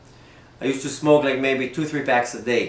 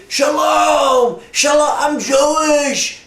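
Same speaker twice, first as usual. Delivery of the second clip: loud, high joyful yelling; excited shout; 13 semitones higher, 6 dB louder, much slower than usual. Retold or imitated. Stranger in Western Union shouting excitedly at the narrator. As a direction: shout it across the room with delight. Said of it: "person starts screaming towards me".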